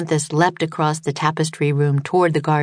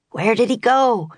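higher, angry call; shout; 6 semitones higher than usual. A narrator's reading. Baylor shouting in the fog for the unicorn. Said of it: "Baylor shouted".

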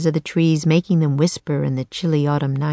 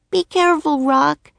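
high-pitched, mild shout; calling out; 9 semitones higher than usual. A narrator's reading. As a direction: call after a departing bird in a girl's voice. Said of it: "Annie shouted".

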